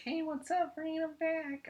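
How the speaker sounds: mocking her with a high falsetto; mocking girly voice. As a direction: squeak it out like a fake-sweet girl. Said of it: "And she's like".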